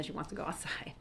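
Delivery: fast whispered aside; quick hushed whisper. Real, not acted. Aside while dog interrupts.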